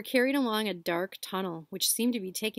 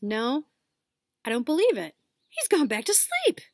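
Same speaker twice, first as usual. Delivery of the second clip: put-on higher, louder surprised boy; boy's voice; 6 semitones higher, 6 dB louder than usual. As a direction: disbelief rising to a squeak. Ron checks the rat.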